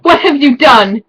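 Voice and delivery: high, loud mock anguish; distraught cry. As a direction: wail it melodramatically. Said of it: "He started to cry".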